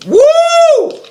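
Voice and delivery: excited. Real, not acted. Cheer after burping.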